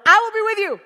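loud, high, insistent declaration; emphatic divine promise. Acted. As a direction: proclaim it forcefully as God. God cuts in with the refrain.

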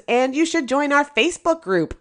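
ordinary talk, nothing marked

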